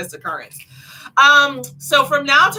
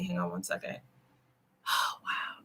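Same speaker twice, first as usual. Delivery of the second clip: whispered aside; 4 semitones lower, 17 dB quieter than usual. Real, not acted. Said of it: "aside while checking the message".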